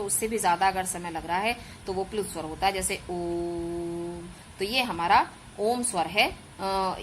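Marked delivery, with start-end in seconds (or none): 3.1-4.3 ओम drawn out long and slow, "Ooom"; ओम with a pluta vowel, stretched. Shown as a demonstration. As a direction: hold the vowel in ओम well past a long vowel. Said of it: "तो ये हमारा खींच के बोला जाता है थोड़ा सा".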